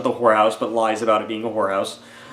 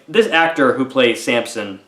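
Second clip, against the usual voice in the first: ordinary talk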